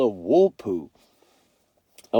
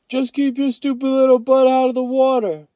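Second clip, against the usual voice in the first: high, loud mocking warning; scornful older brother; 14 semitones higher, 6 dB louder than usual. A narrator's reading. Byron warns Kenny away from the water, sneering. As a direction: sneer it as a parting shot.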